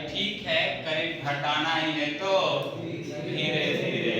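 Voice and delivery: a lightly voiced inductor, stretching धीरे धीरे very slowly; inductor voice, drawn out. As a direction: play the stubborn inductor, easing off slowly. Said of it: "बोलेगा कि". (धीरे धीरे को बहुत खींचकर, हल्का इंडक्टर का अंदाज़)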